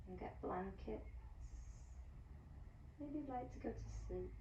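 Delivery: soft, hushed Savasana guidance; guided relaxation. Acted. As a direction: talk them gently down into rest.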